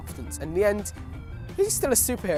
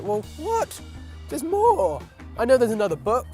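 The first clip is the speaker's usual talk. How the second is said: surprised; 6 semitones higher, 3 dB louder than usual. Retold or imitated. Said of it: "and I was like".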